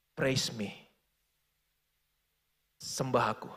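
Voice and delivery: low, soft voice of God speaking; a hushed divine command, low and quiet. Retold or imitated. God calling Frank to praise, low and soft. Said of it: "Tuhan ngomong sama dia".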